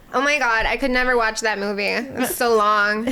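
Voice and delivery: put-on American drawl, slowing at the end; bored American moviegoer parody; imitated American accent. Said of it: "That's a really good accent".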